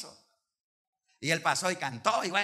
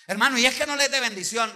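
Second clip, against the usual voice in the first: pushy pleading; 8 semitones higher, 10 dB louder, faster than usual. Retold or imitated. Tither pushes his gift again.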